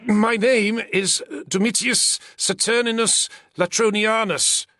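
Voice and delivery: high, slow, emphatic name; exclaimed. A narrator's reading. Frightened senator exclaims his name to his captor. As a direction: announce the name with alarmed insistence. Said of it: "the senator exclaimed".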